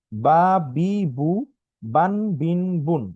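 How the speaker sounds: বা, বি, বু, বান, বিন, বুন each short; syllable drill. Shown as a demonstration. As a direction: model the short forms. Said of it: "বিভিন্ন উচ্চারণের ক্ষেত্রে". (ছোট উচ্চারণ অনুশীলন)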